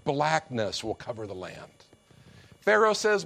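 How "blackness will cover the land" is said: lower, softer, grave pronouncement; God's verdict. Acted. God answering proud Pharaoh, low grave verdict. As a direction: speak it down low like final judgment. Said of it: "God says".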